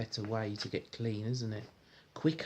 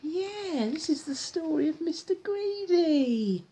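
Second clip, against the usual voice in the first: high, bright-eyed reading voice; excited praise; 5 dB louder than usual. Real, not acted. Praising child, starting the story.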